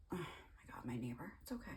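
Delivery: soft unvoiced whisper to the neighbor; quick whispered reassurance; whispered aside. Real, not acted. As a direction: hush it so nobody hears. Aside to neighbor during recording.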